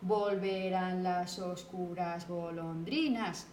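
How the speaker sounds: slow, low, even monotone, syllable by syllable; syllable-counting recitation. Shown as a demonstration. Teacher recalling how school poems were measured, syllable-counting recitation. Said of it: "And we counted the syllables".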